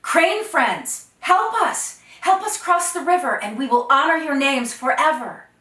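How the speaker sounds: loud, high plea to the cranes; young man calling to the cranes for help. Acted. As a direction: cry out for help across the river. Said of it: "Oh, Dakota called out".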